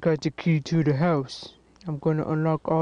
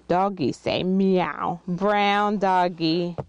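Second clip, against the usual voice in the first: ordinary talk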